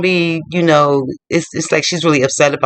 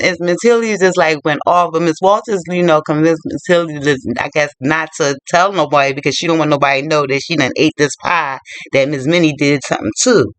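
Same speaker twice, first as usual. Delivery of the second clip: ordinary delivery, nothing marked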